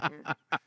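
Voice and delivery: very soft, high chuckle; small stifled laugh in imitation; imitated nervous laugh. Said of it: "My laugh is like".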